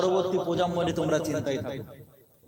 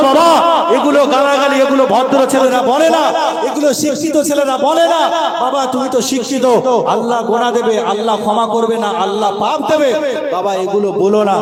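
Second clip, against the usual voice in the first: loud, raised-pitch pleading; impassioned admonishing; 6 semitones higher, 7 dB louder than usual. Acted. Preacher voices how mother should teach son, impassioned. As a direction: admonish the child at full preaching force.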